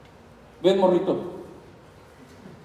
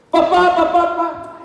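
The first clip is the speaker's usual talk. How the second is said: put-on child calling; 8 semitones higher, 5 dB louder than usual. Child calling his father.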